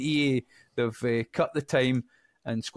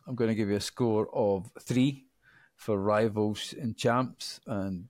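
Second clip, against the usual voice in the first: usual speaking voice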